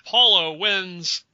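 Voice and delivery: slow, booming award reveal; dramatic announcement. Acted. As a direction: announce it like a grand award show.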